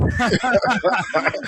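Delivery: big burst of laughter; a loud laugh after the punchline. Real, not acted. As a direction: laugh out loud at your own joke. Laughs at own joke.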